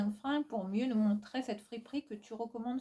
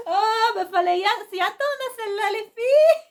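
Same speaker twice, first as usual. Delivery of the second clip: exclaiming; 13 semitones higher, 10 dB louder than usual. Real, not acted. Exasperated reaction to hater comment.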